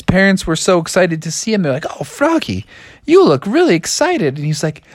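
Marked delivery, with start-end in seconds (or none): 2.0-4.4 high-pitched doting parent voice; delighted parent. Acted. Parents greet Froggy.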